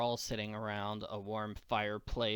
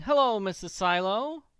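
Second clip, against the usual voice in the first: higher, bright running greeting; cheerful Annie; 7 semitones higher, 6 dB louder than usual. Acted. Annie runs to greet Missus Silo, high and happy. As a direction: greet an old friend gladly.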